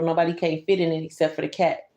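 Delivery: usual speaking voice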